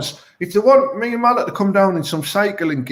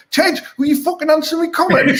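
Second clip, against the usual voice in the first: angry commenter; 10 semitones higher than usual. Retold or imitated. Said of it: "someone just wrote".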